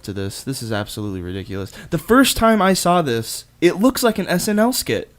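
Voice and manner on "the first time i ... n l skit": higher, louder, exasperated. Real, not acted. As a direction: vent in disbelief. Exasperated outburst.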